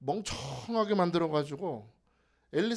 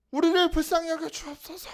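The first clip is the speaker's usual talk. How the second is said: higher, strained beggar's cry; pleading beggar; 9 semitones higher, 5 dB louder than usual. Retold or imitated. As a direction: whine it like a begging blind man. Blind men's cry imitated. (구걸하듯 애원하는 목소리)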